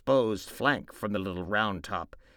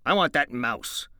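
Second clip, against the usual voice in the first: stern angry George; 6 semitones higher, 9 dB louder than usual. Acted. George to Lenny, angrily insisting on the mouse. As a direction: lean on every word.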